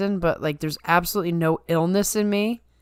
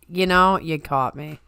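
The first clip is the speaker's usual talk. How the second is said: usual speaking voice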